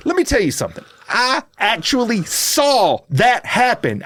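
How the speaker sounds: indignant shout, words stretched out; heated retold rant. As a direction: rant at the cop, pitch climbing to a shout. Angry retold rant at cop.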